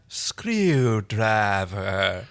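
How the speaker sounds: very slow, stretched syllables; slow sounding-out. A narrator's reading. Erin tries out an unfamiliar word, very slowly. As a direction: roll the tongue over every syllable. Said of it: "Erin said slowly as she rolled her tongue over the syllables".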